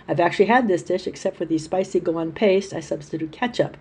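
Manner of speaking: normal talking voice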